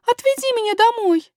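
put-on very high, pleading child; acted high-pitched whimper; sobbing princess. A narrator's reading. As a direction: beg tearfully in a little girl's voice. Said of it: "всхлипнула принцесса".

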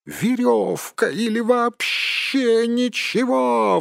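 high, drawn-out creature squeal; Gollum. A narrator's reading. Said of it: "взвизгнул Голлум". (протяжный высокий визг)

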